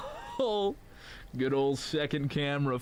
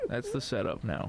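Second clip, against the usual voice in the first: ordinary talk, nothing marked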